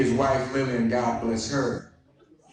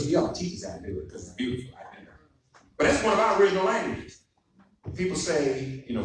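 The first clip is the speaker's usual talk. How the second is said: ordinary talk, nothing marked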